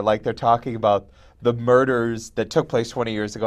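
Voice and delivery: ordinary talk, nothing marked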